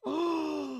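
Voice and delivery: gasp, then high loud "oh"; hammed-up high gasp of inspiration; imitated excited gasp. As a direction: mimic a friend's loud, thrilled realization. Acts out friend's excited reaction.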